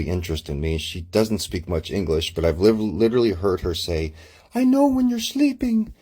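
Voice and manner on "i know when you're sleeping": very high, put-on woman's voice; imitated creepy woman. Woman with an unhealthy interest in him, imitated creepy voice. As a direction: mimic her in a much higher pitch.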